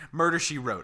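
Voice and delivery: ordinary talk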